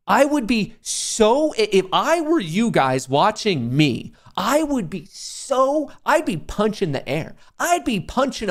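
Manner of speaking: high, slow, drawn-out exaggerated fury; mock outrage. Streamer to viewers about their envy, hammed-up outrage. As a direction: ham up imagined rage.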